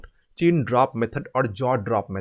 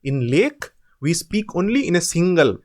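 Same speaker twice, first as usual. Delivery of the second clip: normal talking voice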